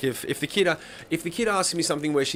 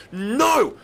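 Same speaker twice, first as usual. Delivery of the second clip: high, loud, slow scolding; horrified checkout lady; 7 semitones higher, 5 dB louder, much slower than usual. Retold or imitated. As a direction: scold him in a shocked high voice. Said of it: "She's like".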